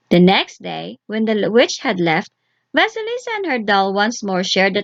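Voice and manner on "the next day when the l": plain reading voice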